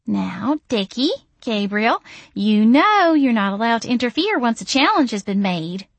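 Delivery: sweet high-pitched coo. A narrator's reading. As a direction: coo it to charm them. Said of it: "she cooed".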